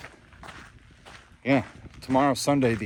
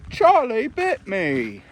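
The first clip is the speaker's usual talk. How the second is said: put-on high, loud, slow little-kid voice; mock toddler cry of protest, pitched way up; imitating the Charlie bit me kid; 11 semitones higher, 5 dB louder, slower than usual. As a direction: do the kid from the famous viral video. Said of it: "where he's like".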